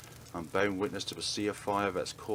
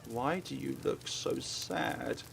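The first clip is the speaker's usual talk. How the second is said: slow, softer and sorrowful; sad quiet question; 4 semitones higher, 4 dB quieter, much slower than usual. Acted. Dying girl asks her killer, slow and sad. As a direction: ask gently as life fades.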